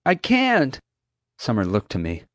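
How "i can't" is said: high, panicked refusal; scared high voice; frightened protest. A narrator's reading. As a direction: cry it out in fear, pitched up. Terrified girl dangling from branch.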